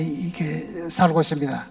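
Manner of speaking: ordinary talk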